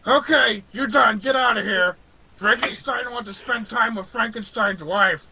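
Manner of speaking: lightly voiced Frankenstein's monster; monster dismissal. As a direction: shoo them off in broken English.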